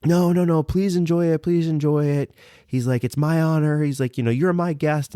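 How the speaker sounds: high imitation of an insistent host; gracious insistence. Store owner pressing a guest to enjoy, high insistent imitation.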